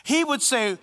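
usual speaking voice

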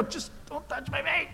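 high, squeamish retold protest; squeamish plea. Retold squirming at chiropractor.

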